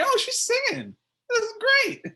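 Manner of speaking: very high mock excited voice; mock delighted squeal. Mock reaction to singing.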